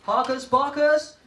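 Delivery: loud, high call to the class; attention-getter call. Shown as a demonstration. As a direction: sing out the cue to get pupils' attention. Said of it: "For example, I say".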